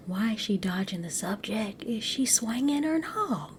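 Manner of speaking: conspiratorial whisper; whispered aside. Real, not acted. Sharing gossip with viewers, a hushed whisper.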